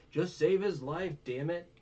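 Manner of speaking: high, loud acted outburst; mock-angry urgent order; exasperated demand. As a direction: snap it like a fed-up rescuer. Voicing Zoro exasperated with the doctor.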